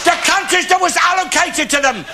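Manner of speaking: builds into a shouting rant; bigot's tirade rising to a shout. Acted. Alf ranting, building.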